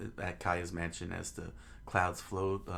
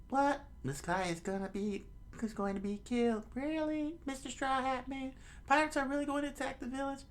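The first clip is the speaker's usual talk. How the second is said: put-on very high falsetto kid voice; squeaky childlike questioning; incredulous child. Children ask Luffy about the pirate attack, high child voice.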